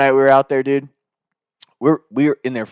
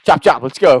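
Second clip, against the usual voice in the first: loud, higher, rallying the crew; pushy work call; 6 semitones higher, 7 dB louder than usual. Retold or imitated. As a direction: bark it like a foreman hurrying the lads. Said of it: "he's like".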